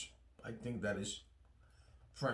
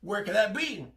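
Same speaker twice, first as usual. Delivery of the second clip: playful hammed-up gasp of a curious viewer, pitched higher; mock a viewer's surprise, higher and louder; mock surprised viewer; 7 semitones higher, 4 dB louder than usual. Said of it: "You say".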